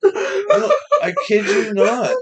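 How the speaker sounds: laughing through the words; can't stop cracking up as you insist; giggling insistence. Real, not acted. Cracking up over the banana.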